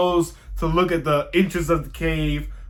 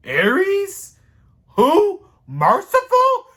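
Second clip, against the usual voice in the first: much higher, mock disbelief; incredulous Clarice; 5 dB louder, much slower than usual. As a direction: squeak out each word in disbelief. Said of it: "Clarice said".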